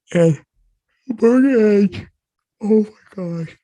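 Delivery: words swallowed by a big yawn; high, loud, stretched-out yawning speech. Real, not acted. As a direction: keep talking right through a yawn. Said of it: "I keep yawning".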